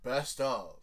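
slow, booming warrior announcer; viking voice. Pretend. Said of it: "Let me get my Viking voice ready".

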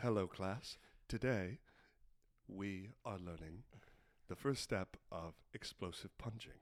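deadpan teacher voice, measured with pauses; solemn class instructor. Acted. Instructor addresses class on explosive punching, solemn low mock lecture.

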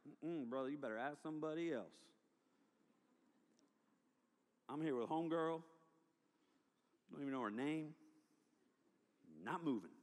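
low, soft, dry refusal; mock digging in, under your breath; reluctant refusal. Camper refusing the altar call, low and unbudging. Teen refuses to come forward.

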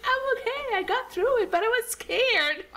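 very high, tearful self-imitation; mock crying. Runner reassuring her team through tears, mock crying. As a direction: re-enact sobbing it out, slowing on the last words. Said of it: "crying, telling them the story".

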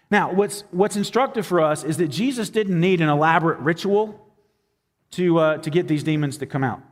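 ordinary talk

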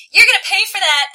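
loud, high-pitched yell. A narrator's reading. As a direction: shout it as a threat. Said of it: "I yell".

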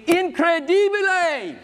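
drawn out Italian-style incredibile, rising to the top; Italian exclamation. Retold or imitated. Preacher borrowing his Italian brothers' word, in a lively Italian accent. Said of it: "my Italian brothers would say".

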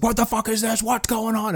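high-pitched acted panic; mock alarm. Acting out startled police at truck.

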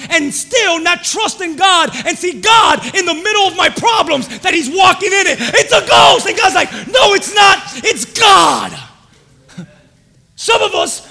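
loud, high, impassioned sermon; full-force preaching. Acted. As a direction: preach it with fire.

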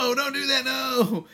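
high-pitched pretend panic; mock pleading. Co-host re-enacts begging the girl not to tell, mock pleading. Acting out alarmed plea to her.

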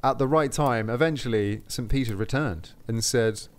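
plain reading voice

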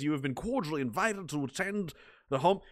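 lightly put-on, deliberately ridiculous British accent; a mock posh Brit reading an invitation; silly British accent. Streamer reading a viewer's invitation in a mock British accent. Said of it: "my voice just immediately spirals into this stupid".

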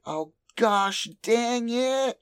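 hammed-up cartoon frustration; frustrated Delta.

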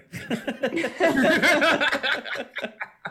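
long, high burst of laughter; laughing. Real, not acted. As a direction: crack up at your own joke. Cracks up at own comparison.

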